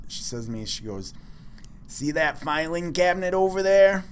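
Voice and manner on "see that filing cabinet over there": a touch of Minnesota vowels, mildly imitated; hint of a Minnesota accent, a bit higher; light Minnesota accent. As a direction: lightly mimic a Minnesota office lady. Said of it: "I kinda threw in an accent".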